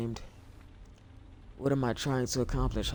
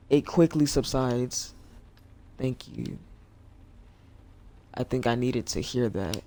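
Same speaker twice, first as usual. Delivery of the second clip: ordinary delivery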